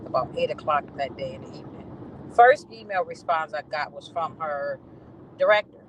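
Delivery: ordinary delivery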